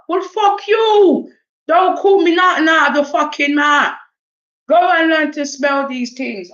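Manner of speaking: high, loud acted outburst; angry retort. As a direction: lash out at the name-callers. Acted row with name-callers.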